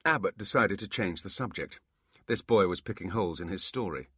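usual speaking voice

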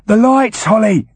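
high urgent call in her head; Foley's voice. Acted.

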